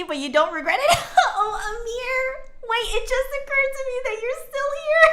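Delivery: giggling in disbelief at a friend; teasing Amir while laughing hard. Real, not acted.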